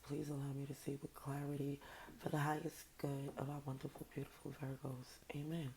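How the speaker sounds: soft, hushed prayer. Acted. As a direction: pray quietly to God. Opening prayer before reading.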